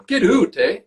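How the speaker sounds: high, hammed-up Canadian "oot" and "eh"; mock Canadian. Shown as a demonstration. Actor mocking how people impersonate Canadians, hammed-up Canadian. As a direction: lay the Canadian accent on thick, high up. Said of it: "I love when people impersonate Canadian".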